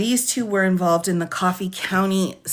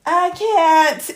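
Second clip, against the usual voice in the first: laughing too hard; 9 semitones higher, much slower than usual. Real, not acted. Overcome by laughter.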